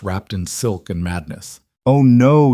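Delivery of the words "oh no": loud, high mock protest; loud, scornful refusal; mocking rebuff. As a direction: throw it out loudly to the whole court. Queen mockingly rebuffs chancellor in court.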